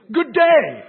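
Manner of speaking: high, loud, drawn-out salutation; hammed-up, stretched-out greeting; hearty greeting. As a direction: proclaim it grandly and slowly. Hammed-up literal translation of bonjour.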